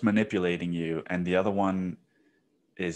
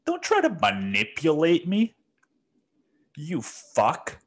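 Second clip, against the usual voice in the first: higher, heated rant; angry outburst; 9 semitones higher, slower than usual. Real, not acted. Venting at a pandering candidate, heated higher outburst. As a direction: vent straight at the politician.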